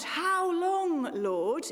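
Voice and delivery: high, loud anguished cry; preacher voicing the cry of the faithful. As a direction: cry it up to God. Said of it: "that crying out".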